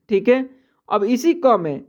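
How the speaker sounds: normal talking voice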